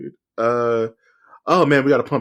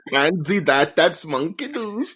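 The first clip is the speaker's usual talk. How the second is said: Carl Pilkington; 5 semitones higher, slower than usual. Acted. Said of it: "Now you sound like Carl Pilkington".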